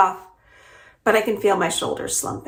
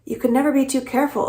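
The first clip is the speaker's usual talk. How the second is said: higher, quicker, mildly exasperated retort; 7 semitones higher, faster than usual. A narrator's reading. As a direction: fire back with a touch of irritation. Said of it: "She sounds exasperated, angry".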